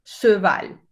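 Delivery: cheval with a rounded schwa, slow; French cheval. Shown as a demonstration. Teacher modelling a French word for students. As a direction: round the lips on the che of cheval.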